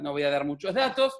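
normal talking voice

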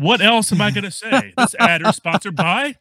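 joking plug for the whiskey he is drinking, in a hammed-up announcer voice; mock ad read. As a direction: play it like a cheesy radio spot. Joking fake sponsor plug.

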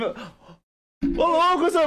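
high-pitched mock protest to the teacher; retold protest. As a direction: imitate a startled student objecting.

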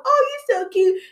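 very high cutesy cooing; baby talk. Acted. Adult gushing over a little baby, cutesy baby talk. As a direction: coo at a baby in a squeaky-high voice. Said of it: "all that baby talk".

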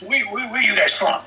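high, insistent cop voice; imitated cop questioning. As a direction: play an officer demanding an answer. Cop interrogates him in story.

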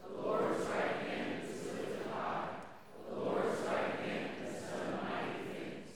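congregation reading together; many voices in unison. Real, not acted. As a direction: crowd reciting in unison. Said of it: "So you'll read what is in gold".

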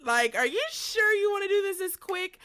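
overwhelmed cry of surprise at God; startled disbelief. Retold or imitated. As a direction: yelp it like the change came too fast. Startled reply to answered prayer.

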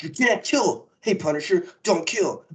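a hint of a lecturing hero; mock Daredevil. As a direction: play the goody hero scolding. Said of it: "moral code of, like".

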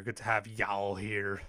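slow Nashville accent drawl on y'all. Shown as a demonstration. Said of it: "little Nashville accent for my".

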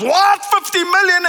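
high, loud "What?" in disbelief; shocked disbelief. Retold or imitated. Delivery clerk hearing a huge order, shocked. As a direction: gasp it out like a stunned clerk. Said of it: "And the guy goes".

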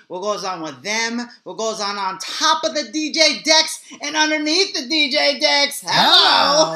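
loud, high hype-man show opener; hype man intro. Acted. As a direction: pump up the crowd like a party host.

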